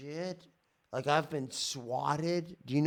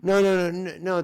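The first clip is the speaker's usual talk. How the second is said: loud protest; 4 semitones higher, 10 dB louder than usual. Real, not acted. Cuts off responder, protesting.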